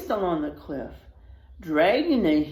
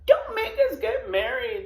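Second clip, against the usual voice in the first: begging; 5 semitones higher, 3 dB louder than usual. Acted. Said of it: "the gang begged".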